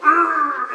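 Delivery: high, sudden effort growl; fighting roar. Retold or imitated. Dreamer grabbing the bar of light, high sudden roar. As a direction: roar as you grab the thing. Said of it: "and I went".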